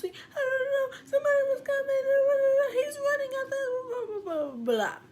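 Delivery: put-on high, loud, frantic kid chatter; all talk at once, panicked; high panicked jabbering; scared kids babbling. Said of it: "scared little kids".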